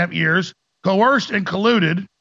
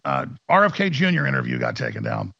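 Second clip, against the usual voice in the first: ordinary talk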